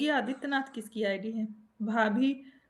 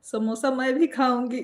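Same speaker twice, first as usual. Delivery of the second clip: normal talking voice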